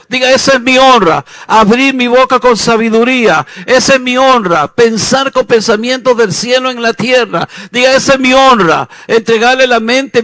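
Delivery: loud, driving preacher's call and response; fiery preaching. Acted. Preacher exhorts the congregation to search the Bible, loud and fiery. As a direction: preach it out at full force.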